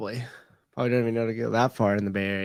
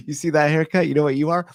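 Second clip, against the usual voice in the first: ordinary talk, nothing marked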